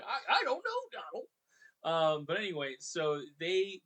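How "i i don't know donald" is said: high goofy cartoon voice. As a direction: squeak it like a bumbling cartoon dog. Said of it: "You get Goofy".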